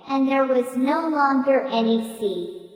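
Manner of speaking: ordinary delivery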